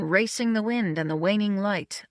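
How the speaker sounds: usual speaking voice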